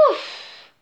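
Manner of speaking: soft, voiceless exhaled "ouf"; sigh of relief. A narrator's reading. Bear's itch is gone, sigh of relief. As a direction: let relief out on a breath. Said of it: "poussa un soupir de soulagement".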